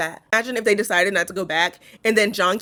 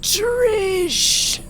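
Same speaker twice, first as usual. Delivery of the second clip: a faint whispered call; barely any air left to say her name; fading asthmatic whisper to sister; breathless whisper; 12 semitones higher than usual. Pretend. Pretend asthma attack, calling weakly.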